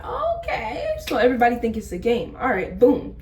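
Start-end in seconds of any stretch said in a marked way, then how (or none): 0.0-0.9 a high, laughing "okay"; real laugh breaking through the story; laughing in disbelief. Real, not acted. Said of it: "laugh cry".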